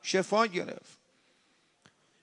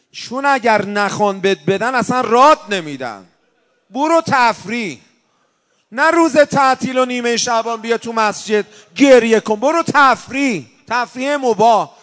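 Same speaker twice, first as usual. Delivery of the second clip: louder, higher, urgent preaching push; full-force preaching; 5 semitones higher, 7 dB louder than usual. Acted. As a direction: drive it home to the congregation. (موعظه با صدای بلند و پرشور)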